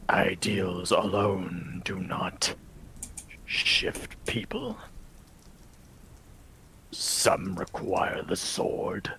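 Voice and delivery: slow, hushed menacing vampire lord; brooding vampire lord. Acted. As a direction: speak as a weary, cursed lord in a hushed voice. Vladimir warns Belagor.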